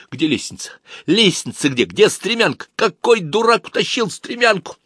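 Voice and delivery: high, loud furious yelling at the stagehands; stage manager yelling at the crew before the show, furious and high; menacing growl. Acted. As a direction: roar out orders like a frantic stage manager. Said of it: "рычал приглушённо, но очень страшно".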